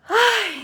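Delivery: a high, loud sigh that falls away; long sigh. Real, not acted. Greeting a viewer on a live stream, a loud falling sigh. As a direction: let out a big sigh before answering.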